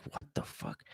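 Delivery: breathless whispered disbelief; stunned whisper. Retold or imitated. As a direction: whisper it, barely voiced. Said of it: "I was like".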